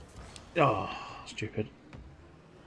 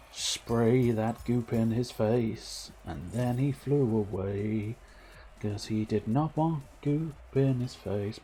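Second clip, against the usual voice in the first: slow comic narration; much slower than usual. Pretend.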